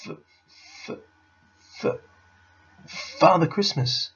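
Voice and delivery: stuck on the first sound, then blurting it high and loud; stuttering fox forcing the name out; frightened stammer. A narrator's reading. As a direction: stammer haltingly, then burst out. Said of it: "stammered the fox".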